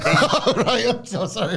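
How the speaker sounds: laughing, high-pitched apology; helpless giggle breaking into the words; cracking up. Real, not acted. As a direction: apologize through real laughter. Cracks up at co-host's impression.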